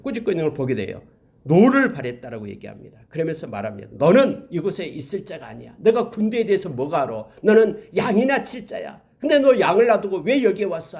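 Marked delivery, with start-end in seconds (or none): 3.9-11.0 raised, louder rebuke, voiced for Eliab; retold scolding. Eliab rebukes young David for leaving the sheep, retold scolding. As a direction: imitate an older brother's scolding, pitch climbing. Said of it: "다윗을 꾸짖고 있는".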